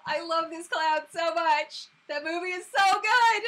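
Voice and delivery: loud, slow, high-pitched gush; emphatic fangirl raving; excited gushing. Real, not acted. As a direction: stretch it out with loud adoration. Gushing about favorite film.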